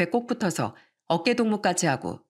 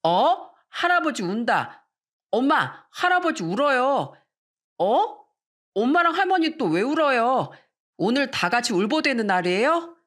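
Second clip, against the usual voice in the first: put-on higher-pitched child voice, puzzled and lilting; curious small child, raised pitch; young grandchildren; 5 semitones higher than usual. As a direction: play a little kid spotting grown-ups crying. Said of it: "저희 애들이 아빠를 가리키면서 이렇게 말하더라고요".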